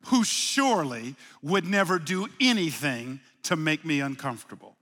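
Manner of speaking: ordinary talk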